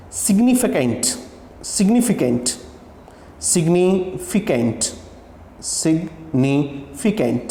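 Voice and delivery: सिग्निफिकेंट repeated, slowing and falling each time; slow model pronunciation. Shown as a demonstration. Teacher repeating सिग्निफिकेंट slowly for students. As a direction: drawl out सिग्निफिकेंट with a clear ए sound. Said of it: "ये इसका सही transcription है".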